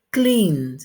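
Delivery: "Cleaned" in one drawn-out syllable; pronunciation demo. Shown as a demonstration. Teacher models "Cleaned" for students, careful pronunciation demo. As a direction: hold the N in "Cleaned" before the final sound. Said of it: "termina en la, el sonido en la N".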